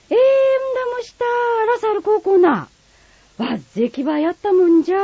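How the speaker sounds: amazed Kagoshima dialect. Acted. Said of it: "鹿児島弁は".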